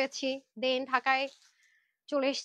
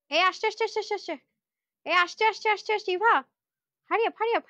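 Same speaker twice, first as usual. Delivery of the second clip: higher, rapid-fire repeated calls; excited urging; 5 semitones higher, 3 dB louder than usual. Real, not acted. Host urging her helper during the live, excited urging. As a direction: hurry someone along excitedly.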